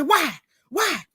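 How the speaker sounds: sharp retold demand for an answer; retold holler. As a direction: imitate him hollering in exasperation. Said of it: "he hollered at her just like that".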